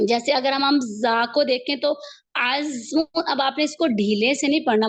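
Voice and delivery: ordinary delivery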